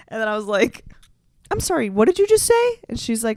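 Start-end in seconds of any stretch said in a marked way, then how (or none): 1.5-2.8 high, louder startled question; shocked disbelief. Retold or imitated. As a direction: blurt it in disbelief.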